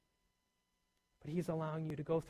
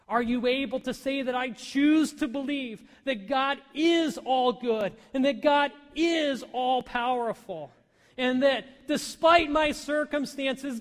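higher and louder, slowing to a drawn-out climax; preaching at full force; 7 semitones higher, 11 dB louder, slower than usual. Acted. Preacher urging the congregation to trust God, full-force preaching. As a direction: build the altar call to a peak.

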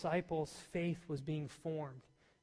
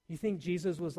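ordinary talk

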